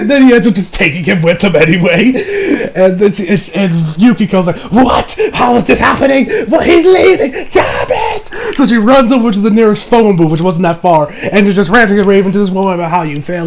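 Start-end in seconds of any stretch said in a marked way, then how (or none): 4.7-8.6 high, loud exasperated outburst, acted out; mock outraged panic. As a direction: play a thwarted character losing it, pitch climbing. Voicing Yukiko's outraged panic.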